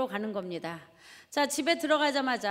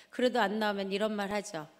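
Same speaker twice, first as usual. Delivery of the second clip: ordinary talk, nothing marked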